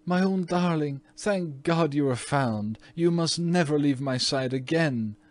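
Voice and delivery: put-on a relieved mother holding her child close; murmured. A narrator's reading. Mother comforting her trembling daughter, murmured. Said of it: "murmured Missus Otis".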